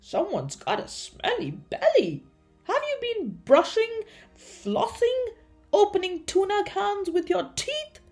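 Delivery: high, rising interrogation; accusing questions. A narrator's reading. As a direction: quiz the patient in comic disgust. Dentist scolds smelly patient.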